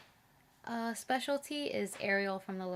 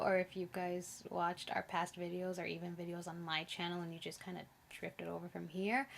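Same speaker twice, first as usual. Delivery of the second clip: normal talking voice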